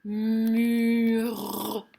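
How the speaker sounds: "Mur" drawn out with a long final r; lengthened "muuurrr" so the last sound is heard; sounding out the word. Shown as a demonstration. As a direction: stretch "Mur" slowly, holding the r at the end. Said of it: "qu'est-ce qu'on entend dans mur?".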